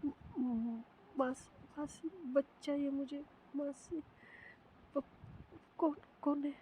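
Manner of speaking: frightened, quick and high, stumbling over words; a terrified girl stammering a prayer in the dark; scared whisper-prayer. Acted. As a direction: panicky stutter, pitch climbing. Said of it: "तो मन में सोचती है".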